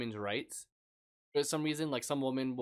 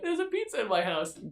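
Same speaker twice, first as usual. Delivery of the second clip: excited quote; 9 semitones higher, 3 dB louder than usual. Retold or imitated. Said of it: "I've never been like".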